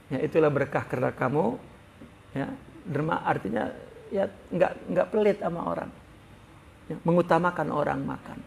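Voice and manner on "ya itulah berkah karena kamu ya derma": softer, calm reassurance; gentle smiling reply. Acted. The Prophet calming the frightened Abu Bakar, gentle and softer. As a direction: soothe him with a smile in the voice. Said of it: "Rasulullah tersenyum".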